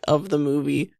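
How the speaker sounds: normal talking voice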